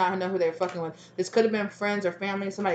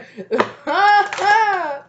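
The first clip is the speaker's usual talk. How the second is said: high, loud cackling laugh; loud burst of laughter; 12 semitones higher, 8 dB louder than usual. Real, not acted. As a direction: let a big laugh burst out. Bursts out laughing at own line.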